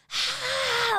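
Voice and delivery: high-pitched scream imitation; retold shocked scream. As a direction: let out a high mock shriek. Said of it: "let out the biggest".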